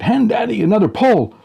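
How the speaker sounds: high, loud shout for help; dad shouting to his kids for a pole; yelling. A narrator's reading. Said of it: "I yell to my little helpers".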